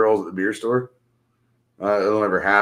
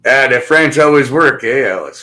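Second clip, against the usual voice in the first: mimicking Alex; 8 dB louder than usual. Reading Alex's chat message aloud, loudly mimicking. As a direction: read it out big, mimicking how they speak. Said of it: "You type just the way you speak".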